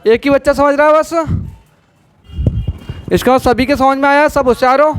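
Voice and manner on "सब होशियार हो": ordinary delivery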